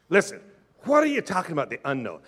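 ordinary talk

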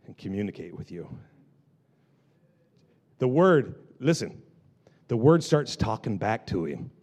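low, soft, confiding delivery; quiet, wondering reverence; hushed preaching. Real, not acted. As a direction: draw the room in with long pauses. Preacher drops to hushed, confiding build-up.

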